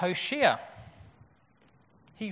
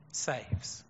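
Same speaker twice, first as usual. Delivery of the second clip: hushed; 6 semitones lower, 6 dB quieter than usual. Real, not acted. Climax of name meaning.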